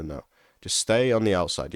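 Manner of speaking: ordinary delivery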